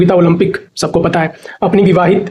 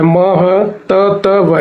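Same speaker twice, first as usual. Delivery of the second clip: very slow मह त त व, each sound separate; spelling it out; much slower than usual. Shown as a demonstration. Teacher showing the double त, sound by sound. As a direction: stretch out मह, त, त, व with both t's. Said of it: "महत्व में double त होता है".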